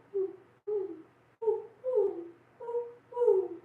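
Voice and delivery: high rising owl hoots; hooting owl call. A narrator's reading. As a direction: hoot it out like an owl greeting dawn. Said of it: "with a special hooting call".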